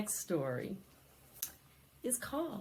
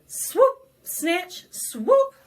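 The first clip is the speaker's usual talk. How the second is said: much higher, louder swooping sound words; loud high swoop, snatch, swoop; pouncing tiger; 10 semitones higher, 11 dB louder, much slower than usual. A narrator's reading.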